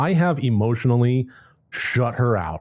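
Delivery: ordinary delivery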